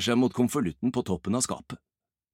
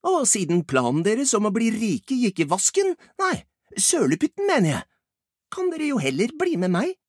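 high-pitched character voice, animated; pointed teasing; 12 semitones higher, 4 dB louder than usual. Acted. Ibbi mocks their failed plan to get rich, teasing. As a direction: needle them in a high, lively voice.

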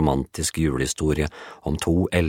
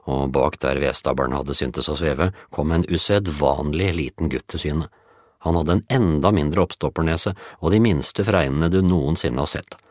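ordinary delivery

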